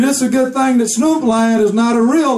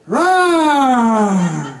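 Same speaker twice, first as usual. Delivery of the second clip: lion roar. Acted. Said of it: "And he would be like".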